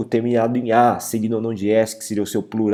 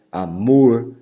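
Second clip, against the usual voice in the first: amor with MOR drawn out and louder; stress on final syllable; 4 dB louder than usual. Shown as a demonstration. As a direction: lean hard on the end of amor. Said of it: "pronunciado com muita intensidade".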